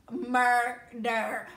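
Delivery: MURDER drawn out syllable by syllable; dramatic emphasis. Real, not acted. Girlfriend warning a lenient dad, dramatic stress on MURDER. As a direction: land on MURDER slowly, breaking it in two for drama. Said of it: "that's probably a little dramatic".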